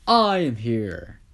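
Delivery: loud, drawn-out hero proclamation; booming hero entrance. Acted. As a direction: announce your arrival big and slow. Hero announces his arrival.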